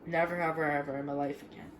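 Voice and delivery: fighting tears, barely above a murmur; holding back tears. Real, not acted. Kid mourning the family cat Peaches, quiet and tearful. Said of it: "I'm trying not to cry again".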